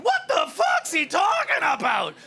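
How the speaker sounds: high, slow, incredulous yell; bewildered thug shouting; baffled yob's shout. Acted. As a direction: holler it in mock outrage. Acts out bewildered London punk.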